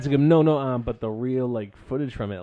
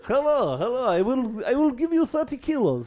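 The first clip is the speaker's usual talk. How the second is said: higher-pitched comic Middle Eastern accent; 6 semitones higher than usual. Shown as a demonstration. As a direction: put on an eager Middle Eastern haggler's accent. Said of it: "as far as my Middle Eastern goes".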